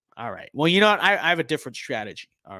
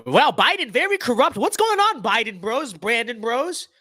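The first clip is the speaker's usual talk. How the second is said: mock hype; 7 semitones higher than usual. Mock greeting, voice jumps high.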